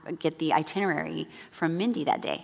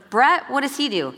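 normal talking voice

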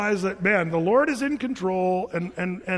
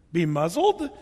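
surprised; slower than usual. Shown as a demonstration. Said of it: "That's something you say to".

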